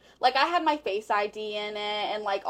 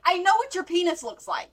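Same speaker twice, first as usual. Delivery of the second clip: higher and louder, acting out the argument; indignant retold confrontation; 8 semitones higher, 5 dB louder than usual. Girlfriend confronting boyfriend over the tape, indignant. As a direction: throw it at him as in the quarrel.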